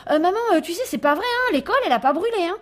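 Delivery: put-on high, loud child stammering out a confession; a small boy hurrying to undo his lie; flustered little boy. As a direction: petit garçon affolé qui avoue. Boy takes back the fire lie.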